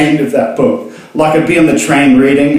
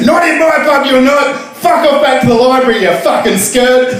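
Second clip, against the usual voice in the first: higher, mock-outraged barman; angry barman; 10 semitones higher than usual. Barman to book reader, mock-outraged shout. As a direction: throw the reader out.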